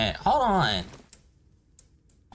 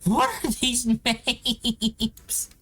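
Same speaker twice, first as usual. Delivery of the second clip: laughing; 7 semitones higher, much slower than usual. Real, not acted. Laughs at odd item names.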